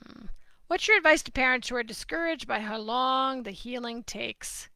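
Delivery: ordinary talk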